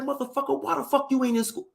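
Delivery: high-pitched dad demanding answers; imitated angry interrogation. Imitating dad questioning son about missing school, high and irate. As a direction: grill the son, voice pushed up high.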